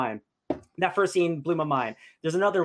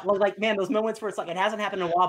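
ordinary delivery

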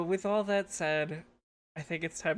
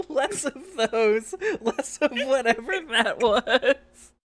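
normal talking voice

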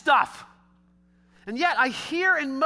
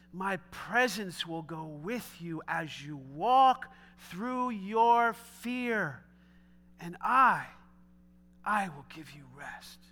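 slow reassurance; 5 semitones lower, 4 dB quieter, slower than usual. Retold or imitated. God's promise repeated to Moses.

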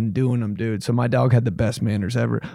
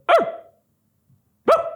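short, sharp imitated dog bark. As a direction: give two quick, separate dog barks. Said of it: "He had that sharp bark".

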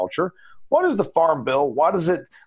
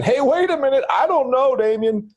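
high, pushback voice of a doubting listener; mocking a doubtful listener, pitched way up; skeptical listener; 11 semitones higher than usual. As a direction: squeak out a mock objection from the audience. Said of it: "where you're saying".

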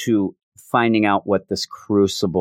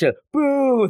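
high, mock booing from the crowd; mock crowd yell; 14 semitones higher than usual. Cult kids booing the Scarlet Witch at Exodus's story, high mock boo. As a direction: boo like a riled-up cult crowd, half-playing. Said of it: "They're all yelling".

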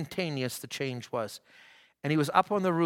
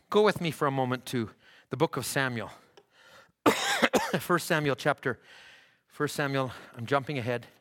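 ordinary talk

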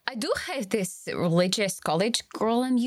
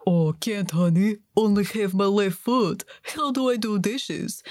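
louder put-on husband voice; mock annoying husband; 3 semitones lower, 4 dB louder than usual. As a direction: make excuses as a hammed-up useless husband. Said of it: "Must have driven his wife crazy".

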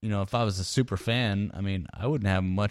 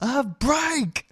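mock drunk girl.

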